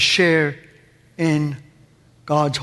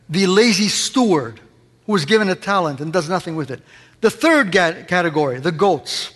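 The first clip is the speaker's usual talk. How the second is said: usual speaking voice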